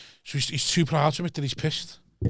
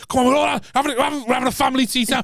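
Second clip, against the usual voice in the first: eager dad; 9 semitones higher, faster than usual. Retold or imitated. Imitates dad rallying family to table.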